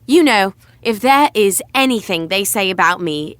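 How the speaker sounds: ordinary talk, nothing marked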